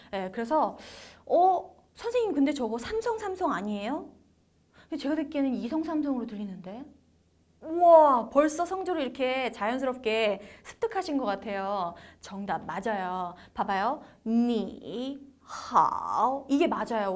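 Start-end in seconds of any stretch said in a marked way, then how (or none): 1.2-6.8 puzzled student. Acted. Teacher voices a puzzled student's question. 14.3-16.4 你好 with two full dipping third tones; original tones. Shown as a demonstration. Teacher showing original tones, 你好 dipping twice. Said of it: "원래 성조가요".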